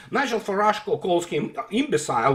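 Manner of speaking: ordinary talk, nothing marked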